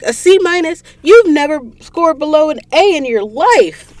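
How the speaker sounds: high, loud mock-shocked outburst; retold disbelief. Friend reacting to a C minus, retold shock.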